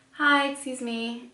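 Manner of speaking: sweetly calling a clerk; polite call-out. Retold or imitated. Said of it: "I was like".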